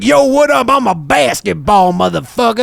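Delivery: brash hype basketball, cocky; swaggering basketball voice. Acted. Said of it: "I went on crazy. I show up. I'm like".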